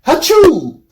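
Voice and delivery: Hachu barked out very high and loud like a sneeze; sneeze-like goodbye. Shown as a demonstration. Inventing a goodbye word for Romania, a loud mock sneeze. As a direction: fake a sneeze as a farewell. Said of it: "goodbye would be more like".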